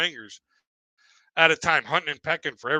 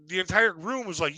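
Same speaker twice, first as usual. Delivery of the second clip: ordinary talk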